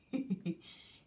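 short light giggle; brief amused chuckle. Real, not acted. Teacher laughing at the angry bird, short giggle.

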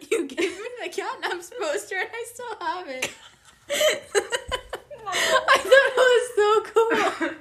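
laughing. Real, not acted. Laughing retelling gift.

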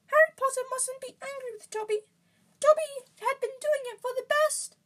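very high, loud squeaky elf; piping, high-pitched creature voice; pleading house-elf. A narrator's reading. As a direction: squeak it out as an anxious little elf.

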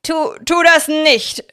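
high, loud, stammering plea; shouting. A narrator's reading. Aaron shouting at Victor to stop. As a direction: cry out to stop him. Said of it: "schrie Aaron".

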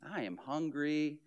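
low, soft, put-upon sigh; fishing for attention. Retold or imitated. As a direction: complain softly so they'll ask why. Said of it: "It's like".

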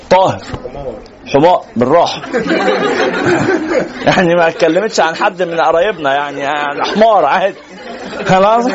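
amused, talking through laughter; laughing. Real, not acted. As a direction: chuckle as you explain yourself. Room laughs at his donkey joke. (بيتكلم وهو بيضحك)

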